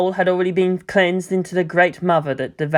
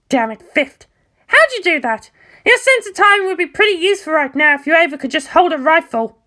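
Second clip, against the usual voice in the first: put-on higher, cocky, blunt girl voice; brash pilot Asuka; 12 semitones higher than usual. Asuka telling Mayumi it's not her battlefield, higher brash voice. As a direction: voice Asuka high and sure of herself, talking down.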